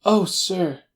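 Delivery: high, loud, overwrought little man; emotional cry. A narrator's reading. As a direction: cry out with quivering eagerness. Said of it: "he cried".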